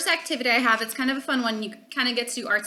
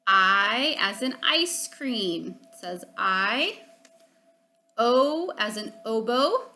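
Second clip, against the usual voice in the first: slow long vowel demo; slower than usual. Shown as a demonstration. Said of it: "they say their name".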